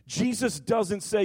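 ordinary talk, nothing marked

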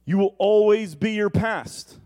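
loud, high, stressed ALWAYS; the lying voice, pressing hard; accusing voice. Acted. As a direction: hammer the word always like an accuser. Voice of the accuser quoted.

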